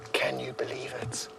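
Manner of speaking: breathless whisper of disbelief. Real, not acted. Guide whispering to viewers after the elephant leaves, awed. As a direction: whisper it, still shaken.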